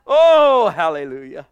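a rising shout of joy; ecstatic praise. Real, not acted. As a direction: burst out in praise. Preacher's exclamation of praise.

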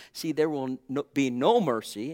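usual speaking voice

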